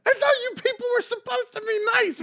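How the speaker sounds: high-pitched mock outrage; comically indignant, high voice; mock indignation. Mimicking comic snapping at crowd.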